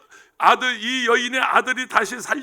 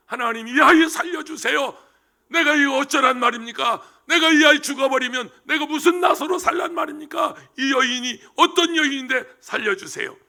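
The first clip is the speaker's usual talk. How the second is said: desperate prayer; 5 semitones higher, 3 dB louder than usual. Acted. Elisha praying over dead child.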